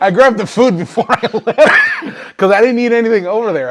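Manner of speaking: loud giggly confession; laughing. Real, not acted. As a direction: confess it while cracking up.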